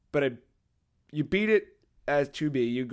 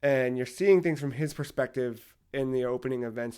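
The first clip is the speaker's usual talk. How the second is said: usual speaking voice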